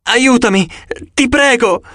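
high, strained begging; desperate pleading. A narrator's reading. Wounded Luke begging Annabeth to save him. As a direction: plead as if your life depends on it.

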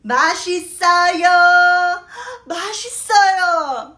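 loud, eager praise of the food; hammed-up delight over the meal; enthusiastic delicious. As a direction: rave about the food to please them. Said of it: "what are you going to say?".

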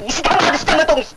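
high, furious swearing; angry cursing. Acted. Tenant cursing the landlord, angry cursing. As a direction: spit the curse out high.

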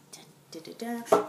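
mock fanfare. Dramatic reveal fanfare.

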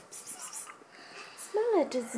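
whispered coax to a cat; whispering. Acted. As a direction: breathe it to the cat.

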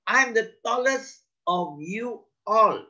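high, mildly yelled boast. A narrator's reading. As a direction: shout out proudly, pitched well up. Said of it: "quickly shot out and yelled".

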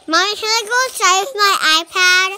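loud, fast, pleading calls to Mommy; calling out. Real, not acted. Child begging Mommy to let her film outside, loud pleading calls. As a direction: call across the house to Mommy, begging.

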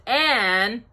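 ordinary delivery

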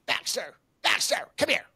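high, airy mock yell calling the dog; mock screaming owner. Dog owner on the golf course, mimicked, high breathy call. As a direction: imitate a careless owner shrieking for her dog. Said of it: "she's screaming".

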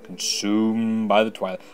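lightly voiced solemn spirit; spirit of twilight. Game player reading the last spirit's lines, solemn spirit voice. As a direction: give the spirit a firm, declaiming opening.

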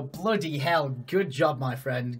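ordinary talk, nothing marked

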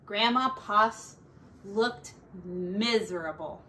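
plain reading voice

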